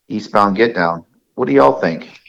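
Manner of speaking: usual speaking voice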